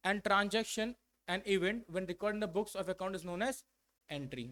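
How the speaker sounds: reads the definition very slowly, word by word; slow dictation. Shown as a demonstration. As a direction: dictate each word for the class to copy.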